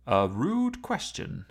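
slow, haughty car voice; a proud machine taking offence, drawn out; lightly voiced snooty car; talking car. A narrator's reading. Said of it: "said a voice from the car's dashboard".

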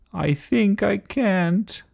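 high, slow chanted refusal; reluctant little engine. A narrator's reading. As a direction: drag it out high like a weary chant.

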